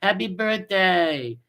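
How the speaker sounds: high, sing-song mock greeting, slow at the end; mocking a friend's syrupy birthday wish. Mocking the friend he failed, in a high sing-song birthday greeting. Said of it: "Jimmy to come in and say".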